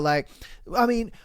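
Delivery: normal talking voice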